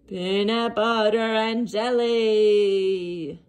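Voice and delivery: slow longing zombie moan. Acted. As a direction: drag it out like a yearning zombie. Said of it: "he moaned".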